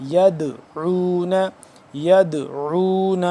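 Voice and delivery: slow یدعون with the د bounced; qalqala demo. Shown as a demonstration. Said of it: "یہ قلقلہ ہوتا ہے". (یدعون میں دال پر قلقلہ، آہستہ)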